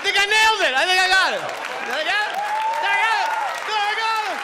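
high, loud shouts of triumph; triumphant shout. Real, not acted. Comic shouting proudly to the crowd after his impression. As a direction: shout it out, thrilled with yourself.

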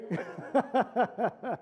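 a real, warm burst of laughter; amused chuckle from the pulpit; hearty laugh. Real, not acted. Laughs recalling the meeting.

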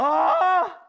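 sharp high cry of effort; hit hard crying out. Real, not acted. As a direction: yell out while straining on the wall. Climber strains on hard hold.

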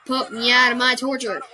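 normal talking voice

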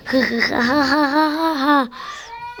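high taunting hahaha laugh; mocking cackle. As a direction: laugh in their face.

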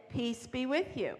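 hushed, unhurried blessing; gentle quoted greeting. Retold or imitated. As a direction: say it slowly and quietly, as Jesus greeting friends. Quotes Jesus greeting the disciples.

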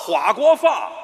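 法国话 loudly garbled as hua guo fa; swapped consonants. Acted. Teacher confidently repeating his mistake, loud garbled 法国话. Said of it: "你想说法国话吧".

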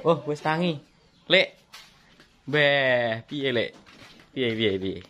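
loud teasing call. Real, not acted. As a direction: call out to wake him up. Waking uncle Tony, calling him.

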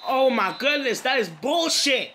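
high, loud outburst at the screen; real indignant shout; outraged yell. Real, not acted. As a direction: blow up at the bad call. Outraged at the game's call.

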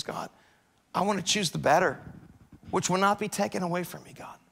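soft, intimate prayer; quiet prayer. Acted. Believer prays quietly during devotions, soft intimate prayer. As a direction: pray softly, as if alone with God.

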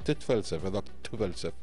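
ordinary delivery

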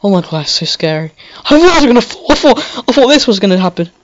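high, loud startled panic; scared gasping gamer; real fright. Real, not acted. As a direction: blurt it out shaken after a near fall. Scared by game moment.

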